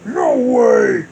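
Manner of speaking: loud villain bellow. Acted. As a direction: bellow it like a monster. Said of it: "Bowser bellowed".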